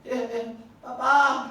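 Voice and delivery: put-on a child too scared to let go. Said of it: "Sabi ng anak".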